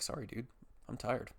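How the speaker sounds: soft, worn-out apology; tired apology. Retold or imitated. Sleeper excusing himself to his cat, soft tired apology.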